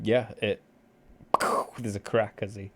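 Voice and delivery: ordinary talk, nothing marked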